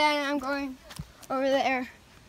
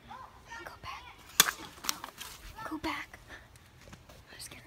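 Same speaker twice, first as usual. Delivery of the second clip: whisper; 8 semitones higher, 10 dB quieter, much slower than usual. Real, not acted. Sneaking back after prank.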